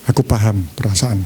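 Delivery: low, calm, attentive reply; understanding. Shown as a demonstration. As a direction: say it as a listener who truly understands. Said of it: "Beda gini".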